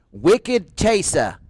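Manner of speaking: slow, drawn-out Boston accent on "Wicked Chaser", r dropped as "Chasah". Shown as a demonstration. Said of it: "the Boston accent".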